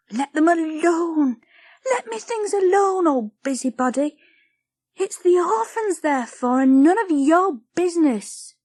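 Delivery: put-on high, furious child; a girl seething at the busybody; silent fury. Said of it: "said the words silently".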